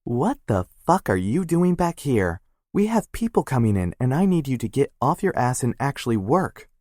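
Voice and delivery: furious sister yelling at her brother; angry sister. Acted. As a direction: barge in and tear into him. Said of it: "Maya slammed the door".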